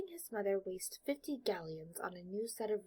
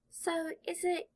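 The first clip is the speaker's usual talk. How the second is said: put-on hesitant boy; 9 semitones higher, 5 dB louder, slower than usual. Boy starts another question.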